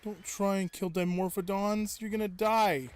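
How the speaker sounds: loud warning yell at a creature; frustrated yelling. Real, not acted. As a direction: shout the warning, annoyed.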